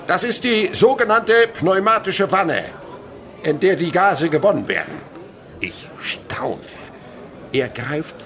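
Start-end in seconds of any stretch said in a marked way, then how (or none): 0.1-5.0 high, loud lecturing voice; proclaiming chemist. Acted. Chemist explaining the water trough to pupils, loud. 5.6-6.6 whisper. Acted. Child narrator marvelling.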